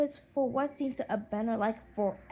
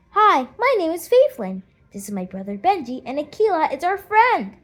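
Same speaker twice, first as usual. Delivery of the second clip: bright, loud friendly hello; a girl greeting a new friend; cheerful introduction; 6 semitones higher, 11 dB louder than usual. Acted. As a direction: introduce everyone with eager cheer. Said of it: "Faithlin introduces them".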